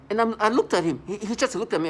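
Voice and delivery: worked-up retelling; agitated. Real, not acted. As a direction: push it out with emphasis. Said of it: "agitated retelling".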